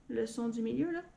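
ordinary talk

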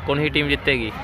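usual speaking voice

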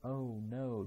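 drawn-out lament, lightly hammed-up; wailing. A narrator's reading. Jay thinks Cole is gone forever, wailing. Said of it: "Jay wailed".

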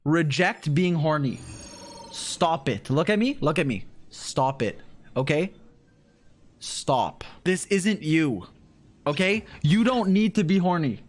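low, measured mock-stern plea; mock intervention. Streamer telling chat to stop being horny, mock intervention. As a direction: talk them down slowly, in a low voice.

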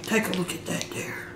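soft unvoiced whisper to the camera. Real, not acted. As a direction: breathe the words out. Showing food to camera.

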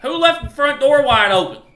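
high, loud holler of alarm; indignant yell. Pretend. Husband finding the front door open, indignant yell. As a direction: demand to know who did it, at full voice.

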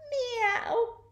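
very high cat meow. A narrator's reading. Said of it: "let out a deafening sound".

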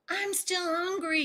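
high cheeky yell. Acted. Bullfrog yelling back while leaping away, high. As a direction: call it back over your shoulder. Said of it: "yelled Bullfrog".

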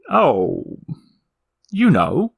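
a cautious, evasive imp; mild wary dodge. Acted. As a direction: hedge warily, like you'd rather not say. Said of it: "said the demon cautiously".

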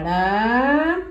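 RA with a long drawn-out A; long syllable. Shown as a demonstration. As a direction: hold the vowel of RA to make it audible. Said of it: "si la repiten larga".

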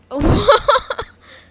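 sudden loud, high-pitched laughing whoop. Real, not acted. Player reacting to the next round, loud high laugh.

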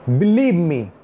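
ordinary delivery

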